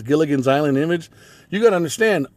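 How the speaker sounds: ordinary talk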